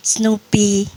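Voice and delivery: ordinary talk, nothing marked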